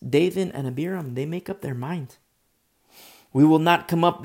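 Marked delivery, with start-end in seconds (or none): 3.3-4.2 loud, flat-out refusal; the rebel sons shouting back at Moses, defiant and loud. Acted.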